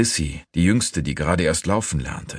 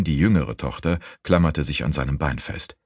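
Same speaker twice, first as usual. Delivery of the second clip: usual speaking voice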